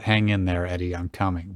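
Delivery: usual speaking voice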